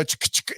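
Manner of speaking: voiceless clicking "ch" noises; imitated gun racking. Man showing how you chamber a gun, imitated racking. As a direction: mimic the rifle's action with clicks.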